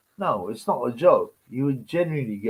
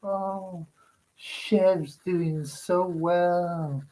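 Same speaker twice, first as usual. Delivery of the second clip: much higher, breathless and very slow fawning coo; mock gushing pundit; 6 semitones higher, much slower than usual. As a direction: swoon over his friend in a dreamy put-on voice. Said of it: "and he's like".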